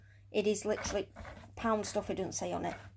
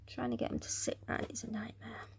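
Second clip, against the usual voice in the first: whispering; 10 dB quieter than usual. Real, not acted. Muttering while arranging.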